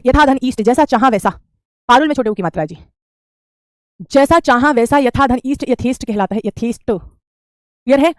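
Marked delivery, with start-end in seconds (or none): none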